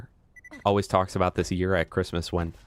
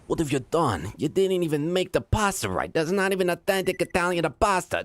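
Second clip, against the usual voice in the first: put-on higher, indignant Italian-accented rant; mock Italian; 4 semitones higher than usual. Streamer mocking Italian pasta gatekeepers, higher Italian accent. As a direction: play an outraged Italian pasta purist. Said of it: "It's like".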